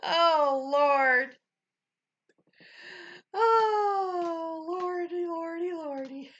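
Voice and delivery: high, loud oh Lordy through laughs; crying with laughter; laughing fit. Real, not acted. Streamer helpless with laughter at chat.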